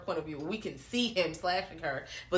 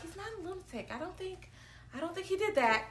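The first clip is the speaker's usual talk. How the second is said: Nancy; 10 semitones higher than usual. Retold or imitated. Host voicing a girl defending Rod, meek mock protest. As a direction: imitate a timid girl defending someone.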